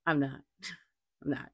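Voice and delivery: soft, laughing repeat. Real, not acted. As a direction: giggle it out softly.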